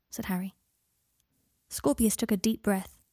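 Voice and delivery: ordinary delivery, nothing marked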